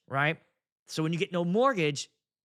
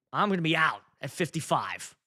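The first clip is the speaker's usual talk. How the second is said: normal talking voice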